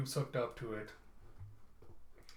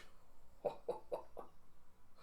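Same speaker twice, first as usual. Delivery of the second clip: breathy unvoiced laugh at the twist; real laugh; 12 dB quieter than usual. Real, not acted. Narrator laughing at the plot twist, real laugh. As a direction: laugh it off in disbelief.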